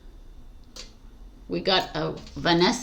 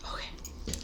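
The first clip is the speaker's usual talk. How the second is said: soft whisper to self; 18 dB quieter than usual. Real, not acted. Telling herself to focus, in a whisper. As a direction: whisper it to steady yourself.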